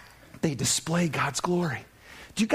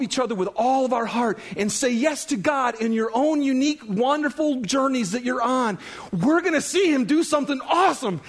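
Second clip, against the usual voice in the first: preaching that builds higher and louder; impassioned preaching; 7 semitones higher, 3 dB louder than usual. Real, not acted. Preacher urging the church to stay strong, building fervour. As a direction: build toward an excited, emphatic promise.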